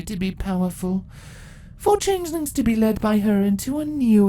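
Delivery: proud empress telling her victory; ancient empress. Acted. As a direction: gloat over the long wait. Empress monologue.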